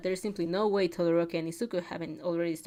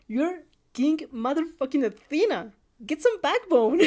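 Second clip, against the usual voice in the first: loud, higher, emphatic scolding; fierce pep talk; 6 semitones higher, 6 dB louder than usual. A narrator's reading. Friend tells self-doubter to get backbone, loud and emphatic. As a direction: drum confidence into them, punching each word.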